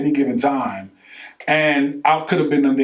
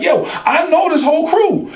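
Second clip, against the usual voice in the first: excited laughing recognition; 13 semitones higher, 4 dB louder than usual. Retold or imitated. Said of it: "And I'm like".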